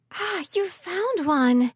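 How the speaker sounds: high, loud, slow delighted cry; light up with sudden glee; gleeful exclamation. A narrator's reading. Callie sees the cello Archer found, gleeful exclamation. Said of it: "Callie exclaimed".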